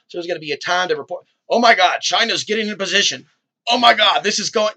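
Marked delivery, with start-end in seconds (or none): 1.5-4.7 loud, high-pitched mock panic; hammed-up breaking alarm. Host parodying urgent conspiracy reports, mock alarm.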